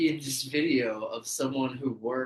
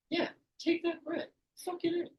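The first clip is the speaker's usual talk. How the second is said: mock squeaky hype. Joking hype in high voice.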